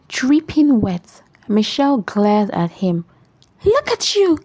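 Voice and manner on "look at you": much higher, scornful yell. A narrator's reading. Michelle yells at her soaked husband, a high scornful yell. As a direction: yell at him in disgust. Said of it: "she yelled".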